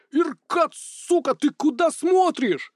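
high panicked yell, dragged out slowly. Acted. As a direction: scream it at him in alarm. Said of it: "заорал он". (панический крик)

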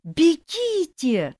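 slow, high desperate plea; pleading. A narrator's reading. Ghost wives beg the girls to run, slow and high. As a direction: beg them to flee. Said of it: "почти хором умоляли призраки".

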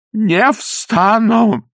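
high, very slow, drawn-out refusal; stubborn refusal. Acted. Kneeling priest refusing to get up. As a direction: stretch it out, refusing to budge. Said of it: "повторил отец Фёдор".

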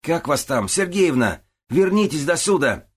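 loud, high-pitched calling out; yelling. A narrator's reading. Man at the open window yelling for Sergeevna. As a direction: holler it to someone far off. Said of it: "заорал во всю глотку".

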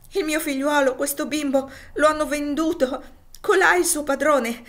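a desperate mother begging for help; frantic plea. Acted. Mother begging a farmer to save her child, frantic and high. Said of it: "disse ella stendendo la mano".

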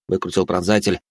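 plain reading voice

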